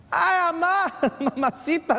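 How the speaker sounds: high, hammed-up wail for mama; mock pained yell. Man with a crushed finger calls his mamá, playful high yell. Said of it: "¿Qué creen que voy a gritar?".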